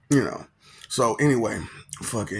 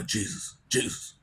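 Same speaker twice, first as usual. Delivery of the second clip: voice from heaven. Retold or imitated. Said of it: "and it was like".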